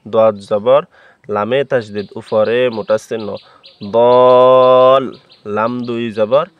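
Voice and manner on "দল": দল with the vowel held long and much louder; four-count madd. Shown as a demonstration. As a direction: pull দল out for four alif counts. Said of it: "চার আলিফ টানি পড়ব".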